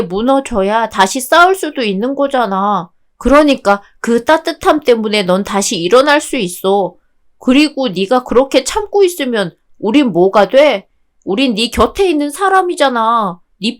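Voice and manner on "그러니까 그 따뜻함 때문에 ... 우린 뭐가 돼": ordinary delivery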